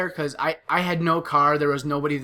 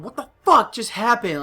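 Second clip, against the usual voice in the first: stunned outburst; 7 semitones higher, 5 dB louder than usual. Retold or imitated. Bleeding after dog attack.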